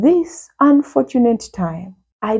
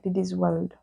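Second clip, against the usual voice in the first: much softer, barely out; sheepish mumble; 10 dB quieter than usual. Retold or imitated. Daughter mumbling the trip destination to mom, sheepish and soft. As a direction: answer mom guiltily under your breath. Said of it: "I'm like".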